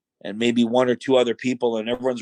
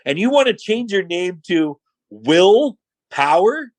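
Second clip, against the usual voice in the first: slow, higher-pitched incredulous question; mocking disbelief; 6 semitones higher, slower than usual. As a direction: draw out "Will Power" into a rising question. Boss repeats name in disbelief.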